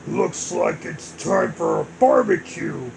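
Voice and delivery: villain voice. Acted. Bowser gloats.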